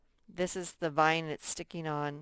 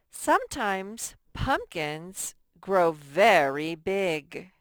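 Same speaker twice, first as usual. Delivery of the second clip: slow and louder, stretching the sentence; slow emphatic reading; 7 dB louder, slower than usual. Shown as a demonstration. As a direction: read it loud and slow to the children. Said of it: "teacher reads model sentence".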